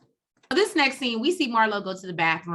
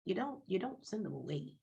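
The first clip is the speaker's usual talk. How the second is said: quiet retelling; 3 semitones lower, 12 dB quieter than usual. Retold or imitated. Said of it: "And Kandi's like".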